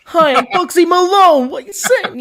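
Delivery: high, slow put-on American accent; American gangster accent. Brit streamer imitating Bugsy Malone's American accent. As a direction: ham up a 1930s American gangster. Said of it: "another American, like, you know, fond accent".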